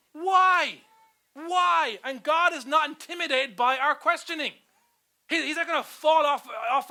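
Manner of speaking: high, loud, impassioned sermon; full-force preaching. Real, not acted. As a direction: ring it out over the congregation.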